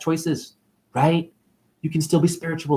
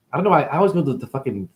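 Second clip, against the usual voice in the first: ordinary talk, nothing marked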